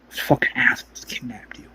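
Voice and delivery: hissed, voiceless and soft; angry whisper. Retold or imitated. John to Avesta about her kidnappers, an angry whisper. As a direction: spit it out under your breath.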